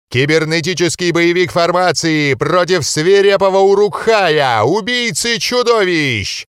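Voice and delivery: громкий, протяжный анонс на весь зал; ведущий боксёрского ринга. A narrator's reading. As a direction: raise the crowd like a ring announcer. Referee hypes crowd, loud, slow. (ведущий на ринге заводит толпу, громко и протяжно)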